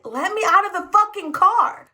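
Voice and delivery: exasperated at the driver's sob story; exasperated demand. Retold or imitated. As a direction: bite back, totally done. Passenger snaps after driver's excuse.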